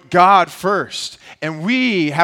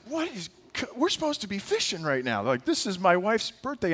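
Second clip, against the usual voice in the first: frustrated inner complaint; 4 semitones higher, 9 dB quieter than usual. Retold or imitated.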